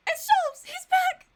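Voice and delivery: high, loud fan scream; overjoyed shout. Real, not acted. Player spotting Sholmes back in the game, ecstatic shouting. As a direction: shriek it as your hero returns. Favourite character returns in game.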